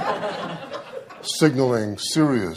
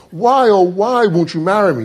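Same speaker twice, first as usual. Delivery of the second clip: high, loud mock anguish; anguished plea; 8 semitones higher, 6 dB louder than usual. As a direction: wail the why, hammed up. Acted proposal, repeated pleading.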